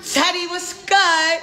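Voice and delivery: a playful high call-out to Teddy; sing-song greeting. Real, not acted. Greeting Teddy on camera, playful high sing-song. Calling out to a friend.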